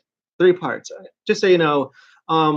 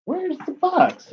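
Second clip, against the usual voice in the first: bewildered; 12 semitones higher, 4 dB quieter, slower than usual. Real, not acted. Searching for lost box.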